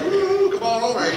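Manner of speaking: high, loud come-hither call; flirty woman calling. Retold or imitated. Tempting woman calling a man from her street, flirty. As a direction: lure the passerby in with a sing-song woo. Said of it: "she goes".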